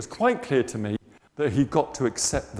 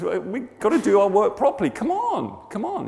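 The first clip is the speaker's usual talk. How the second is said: urging; 8 semitones higher, 4 dB louder than usual. Retold or imitated. Worker nagging a mate to get on, urging. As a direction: chivvy along in a higher voice. Acted quote urging him on.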